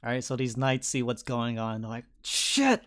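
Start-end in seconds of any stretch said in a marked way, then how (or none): 2.2-2.8 high-pitched startled curse; mock shocked exclamation; acted alarm. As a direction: blurt it like a shocked onlooker. Knights' reaction acted out.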